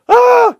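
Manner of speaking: high shrieked Ah; a hammed-up panicked shriek; loud high mock yelp of panic; mock scream. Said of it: "run back inside".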